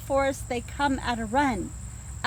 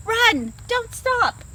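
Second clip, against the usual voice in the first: high, loud, urgent rabbit voices; panicked little rabbits yelling; scared bunnies calling out to flee; rabbits shouting; 7 semitones higher, 4 dB louder than usual. Acted. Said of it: "They shout to each other".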